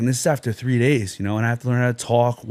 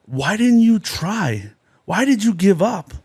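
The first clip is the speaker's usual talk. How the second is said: much higher and louder, searching questions from God; God questioning a soul, higher and louder; 9 semitones higher, 4 dB louder than usual. Retold or imitated. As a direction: ask it as God at judgement, high and raised. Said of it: "And God's gonna say".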